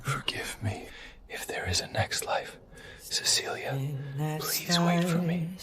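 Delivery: last breath, whispered; dying whisper. Acted. Dying man's last words.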